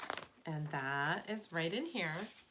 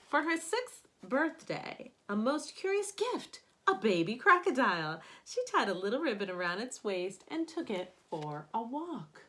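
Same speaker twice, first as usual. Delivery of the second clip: expressive picture-book narration; storybook read-aloud voice; reading to children; 7 dB louder than usual. Acted. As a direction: read it aloud to a class of kids. Reads picture book to class.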